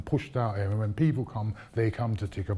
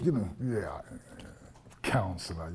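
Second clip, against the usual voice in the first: ordinary talk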